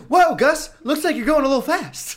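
high, loud goofy cautious voice; high mock Fallon voice. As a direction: squeak out a fussy warning. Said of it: "Fallon's like".

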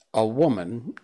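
usual speaking voice

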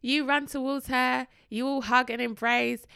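ordinary talk

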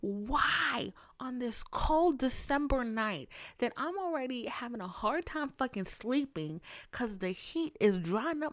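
mock-angry venting; exasperated rant. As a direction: vent it at your brain. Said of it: "I was like".

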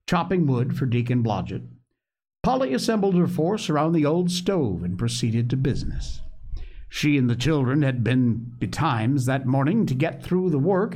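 plain reading voice

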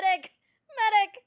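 high, loud cry for a medic; man down shout. Pretend. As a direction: scream for the medic on the battlefield. Calling out for a medic.

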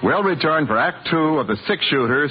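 ordinary talk, nothing marked

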